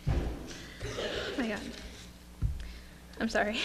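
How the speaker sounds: whispered, tearful gasp of emotion; barely getting the words out through tears. Real, not acted. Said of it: "I'm not gonna cry".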